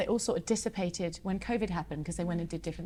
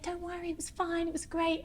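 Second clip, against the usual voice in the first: higher, soft mock-kind fan voice; reassuring fans; 8 semitones higher, 5 dB quieter than usual. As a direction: reassure her like polite fans. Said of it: "they're like".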